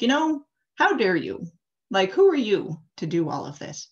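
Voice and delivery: the demon lord furious at being defied; hammed-up villain indignation; indignant Mara. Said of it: "Mara gets so mad".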